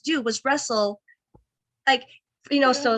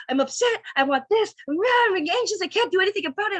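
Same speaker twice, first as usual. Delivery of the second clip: mock rage yelling; 10 semitones higher, faster than usual. Yelling at boyfriend on the phone, retold rage. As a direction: retell the angry outburst, pitch climbing. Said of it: "like, rage yelling".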